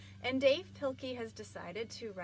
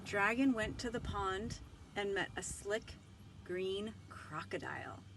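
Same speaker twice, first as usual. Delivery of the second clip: lively storybook read-aloud with voiced characters; reading to children; 3 semitones higher, 3 dB louder than usual. Librarian reading Dragon aloud to children, animated.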